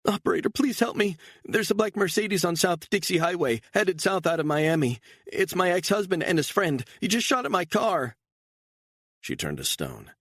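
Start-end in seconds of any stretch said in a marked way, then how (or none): 0.0-8.2 high, frantic woman's plea; frantic fake 911 call. A narrator's reading. Actress faking panic to the emergency operator, high and frantic. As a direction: act terrified for the operator. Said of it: "Beatrice began to cry".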